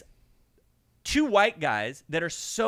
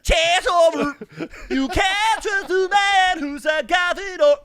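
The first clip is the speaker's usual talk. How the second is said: loud, slow, higher put-on voice; hammed-up voice; 11 semitones higher, 9 dB louder, slower than usual. Joking aside to friend, a loud, slow hammed-up voice. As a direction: boom it out like a mock pronouncement.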